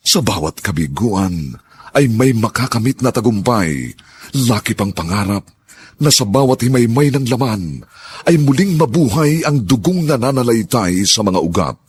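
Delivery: sweeping, emotive narrator read; dramatic radio narration. Acted. Narrator introduces the drama's theme over music, sweeping and emotive. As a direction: build hope through the speech.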